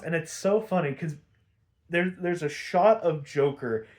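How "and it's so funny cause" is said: usual speaking voice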